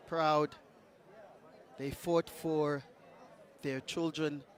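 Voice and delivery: ordinary talk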